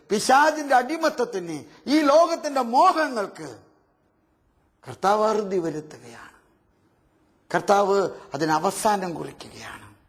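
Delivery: ordinary talk, nothing marked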